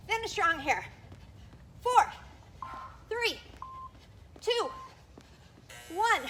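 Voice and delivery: loud, high countdown to the finish; shouted countdown. Real, not acted. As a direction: push them through the last seconds.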